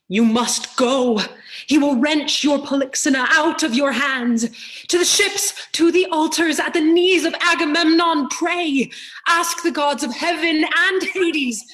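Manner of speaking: high, loud and pressing; urgent loud warning. Acted. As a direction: drive her to the altars with urgency. Chorus urges Hecuba to flee.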